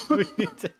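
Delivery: breaking into giggles; words squeezed through a laugh. Real, not acted. Laughs mid-sentence.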